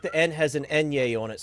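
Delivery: ordinary talk, nothing marked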